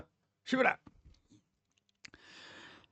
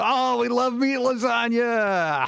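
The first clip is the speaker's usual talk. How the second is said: slow, higher mock-delighted relatives; drawn-out fake delight; Italian relatives; 7 semitones higher, slower than usual. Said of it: "They'd be like".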